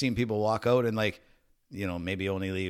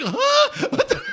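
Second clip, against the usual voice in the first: very high, loud mock bewilderment; startled, half-drunk; 8 dB louder, much slower than usual. Drunk man woken by a police raid, mock startled. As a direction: squeal it as a baffled drunk. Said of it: "He's still half in the bag".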